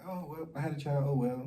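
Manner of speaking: imitating a careless "oh well" attitude; mock dismissive. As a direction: act out shrugging it off lightly. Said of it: "not get over it in a sense of like".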